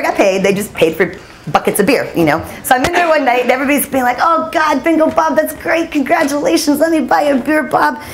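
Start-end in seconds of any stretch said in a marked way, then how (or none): 4.2-8.0 high, lively, gushing bar-crowd voice; cheery congratulations. Retold or imitated. Bar regulars congratulating Bingo Bob, cheery and high.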